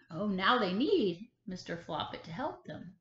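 ordinary delivery

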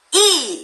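이 starting high and falling sharply; fourth tone. Shown as a demonstration. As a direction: Mandarin fourth tone on 이. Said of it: "한번 사성으로 해보겠습니다".

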